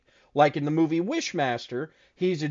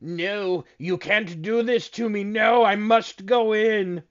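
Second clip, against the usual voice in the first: hammed-up djinn, climbing higher and higher; protesting djinn; 4 semitones higher, slower than usual. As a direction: draw out the words in a mock-dramatic genie voice. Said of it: "the Djinn starts walking away backwards like".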